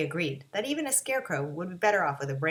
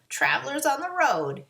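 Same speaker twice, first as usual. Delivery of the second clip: screeching witch; 5 semitones higher, 5 dB louder than usual. A narrator's reading. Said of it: "she screeched".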